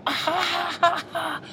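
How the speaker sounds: high mock laugh of a big crowd; imitated audience laughter. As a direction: ham up a crowd roaring at a joke.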